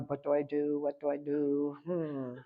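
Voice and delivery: low, hushed puzzling to oneself; imagined inner voice. Retold or imitated. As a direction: imitate someone privately at a loss, very soft and low. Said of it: "is in her head going".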